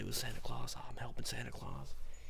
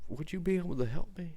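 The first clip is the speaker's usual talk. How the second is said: higher, louder plea; pleading ask; 7 semitones higher, 6 dB louder than usual. Retold or imitated. Asking Santa for help to spread cheer, pleading.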